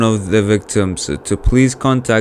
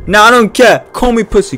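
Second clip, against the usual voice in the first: loud, high defiant shout; defiant yell; 10 semitones higher, 8 dB louder than usual. Real, not acted. Streamer yells back at the game, defiant shout. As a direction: yell it out, shaken but defiant.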